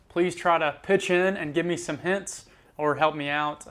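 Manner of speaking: normal talking voice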